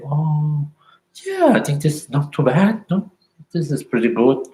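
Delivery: happily surprised by a memory; pleased discovery. Shown as a demonstration. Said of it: "look at them and say".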